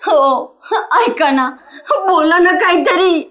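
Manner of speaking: sobbing, begging him to speak; crying. Acted. Wife begging her silent husband, sobbing. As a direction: cry it out, desperate for an answer. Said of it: "नको रडू सुरेखा".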